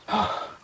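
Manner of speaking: high, sudden gasp; waking gasp. Acted. Adina coming to, waking gasp. Character coming to after being wounded.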